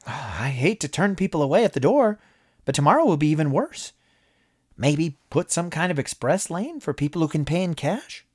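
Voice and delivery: a breezy high-pitched promoter pitching ideas; cheerful businessman Moss. A narrator's reading.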